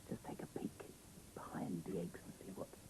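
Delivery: hushed, whispered peek; secretive whisper. Pretend. Reader peeks into the dinosaur nest with the child, whispering. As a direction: whisper so as not to disturb.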